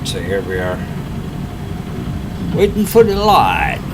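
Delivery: usual speaking voice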